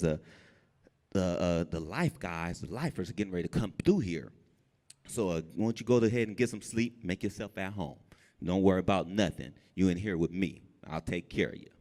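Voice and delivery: a hint of a gentle giant soothing the newcomer; lightly voiced cellmate reassuring the new guy; low reassuring cellmate.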